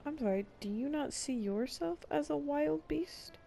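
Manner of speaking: ordinary talk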